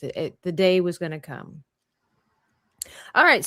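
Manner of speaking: ordinary talk, nothing marked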